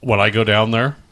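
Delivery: ordinary talk, nothing marked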